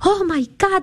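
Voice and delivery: high-pitched retold exclamation of wonder; amazed doctor. Doctor hears the patient's story, amazed exclamation. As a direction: imitate the doctor marvelling aloud. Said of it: "decía el doctor".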